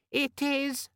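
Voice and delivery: very high, irritatingly friendly squeak; squeaky friendly demon. A narrator's reading. Pocket demon telling Vimes the time, squeaky and friendly. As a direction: chirp it sweetly in a squeaky voice. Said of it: "had the squeaky, friendly quality".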